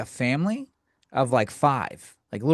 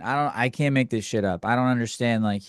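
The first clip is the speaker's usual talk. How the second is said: normal talking voice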